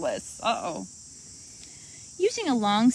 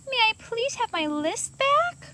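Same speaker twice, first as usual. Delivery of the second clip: high polite voice; 13 semitones higher than usual. Acted. Girl asks bird politely.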